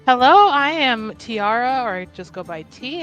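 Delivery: usual speaking voice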